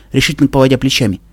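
plain reading voice

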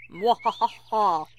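loud, slow villain's laugh; big stagey evil cackle. Pretend. Applejack playing the wicked wolf, evil laugh. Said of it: "do a little evil laugh".

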